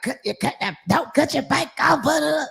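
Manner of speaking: high, mocking imitation of nagging; man giving orders. Retelling what one of his men told her, mockingly. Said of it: "one of his men was like".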